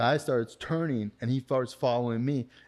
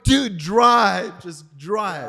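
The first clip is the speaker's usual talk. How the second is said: high, much louder yelled plea; screaming at his buddy while a man punches the window; panicked shout; 7 semitones higher, 8 dB louder, much slower than usual. Retold or imitated. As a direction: yell at the friend to get moving. Attacker punching car window.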